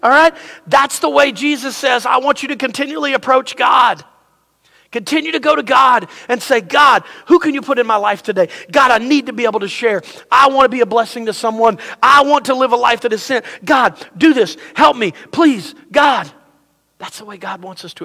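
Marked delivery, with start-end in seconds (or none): 2.0-4.0 preaching. Acted. Preaching at full force. 6.6-13.5 earnest prayer. Acted. Bold prayer to God. 13.6-14.6 GOD, DO THIS cried out loud; preacher crying out a bold demand to God; loud bold plea. Shown as a demonstration. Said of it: "we just say". 14.8-16.3 earnest prayer. Acted. Bold prayer to God.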